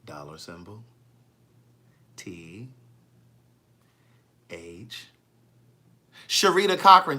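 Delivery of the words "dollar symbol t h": low, soft, one letter at a time; very slow spelling. Shown as a demonstration. Host spelling her Cash App for Stephanie, very slowly. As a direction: leave a long pause after each letter, hushed and deep. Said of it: "let me spell it again".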